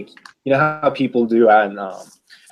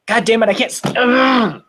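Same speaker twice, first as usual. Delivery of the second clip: frustrated groan; 11 semitones higher than usual. Real, not acted. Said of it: "I can't seem to not do it".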